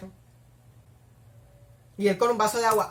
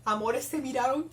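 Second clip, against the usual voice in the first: ordinary talk, nothing marked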